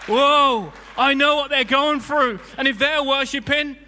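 loud, high, amazed whoa from the next seat; excited neighbour. Retold or imitated. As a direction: burst out as if caught up in the worship. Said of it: "the person sitting next to you going".

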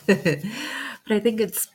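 loud breathy laugh into slow words; tearful laugh, then slowly on; teary laugh. Real, not acted. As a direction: laugh through tears.

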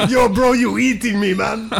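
high, hammed-up Mexican accent; cartoonish Mexican accent pitched high; mock Mexican accent. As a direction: ham up a jokey Mexican Jesus accent. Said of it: "the impersonation's hurting my throat".

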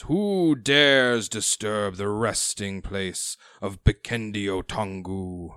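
slow, booming ghostly king; ancient rasping undead king. Acted. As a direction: loom over them, slow and loud. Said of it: "He says".

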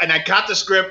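ordinary talk, nothing marked

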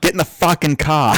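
broad put-on Boston accent. Host quoting a Boston movie, broad Boston accent. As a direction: order them in mock Boston. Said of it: "Whenever I think Boston".

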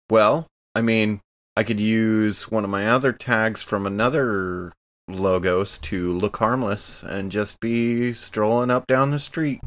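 normal talking voice

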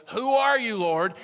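high, loud frightened question; startled cry. Acted. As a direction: cry up into the light. Saul crying out, dazzled.

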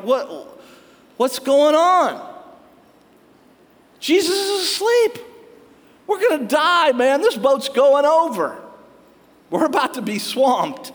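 panicked disciples. Retold or imitated. Disciples in a sinking boat, crying out to sleeping Jesus in panic. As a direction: cry out like terrified fishermen in a sinking boat. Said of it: "they're like".

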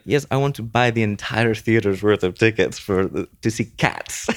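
mock Italian accent, lightly done; Claudio's Italian accent. Husband buying out the theater, bad Italian accent. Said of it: "That's a horrible accent".

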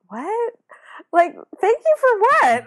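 baffled surprise. Retold or imitated. Teen girl thanked by a shy classmate, incredulous high surprise. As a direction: act out total bafflement.

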